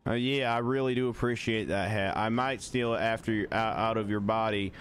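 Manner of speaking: put-on polite Southern accent, deadpan; country Southern accent. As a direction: play a courteous Southern country fellow. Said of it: "I'm stuck in this accent now".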